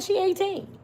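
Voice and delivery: high dismissive cop voice; mock police brush-off; voicing the police. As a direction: brush it off like a bored officer. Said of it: "They like".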